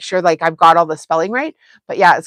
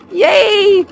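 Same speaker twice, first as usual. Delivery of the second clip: mock cheer; 11 semitones higher than usual. Real, not acted. Repeats cheer after laughing.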